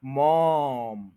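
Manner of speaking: मॉम held very long; stressed syllable. Shown as a demonstration. Teacher shows which syllable to dwell on, stressed and stretched. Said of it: "इस पे stress करना है".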